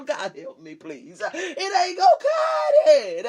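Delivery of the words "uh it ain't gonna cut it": much higher and louder cry of warning; shouting climax. Real, not acted. Preacher warning the church that half won't do, shouting. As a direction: hammer it home at the top of the voice.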